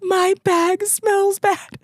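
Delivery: high, slow tearful whimper; imitating herself crying; mock tearful whine; retold crying. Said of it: "And I started crying".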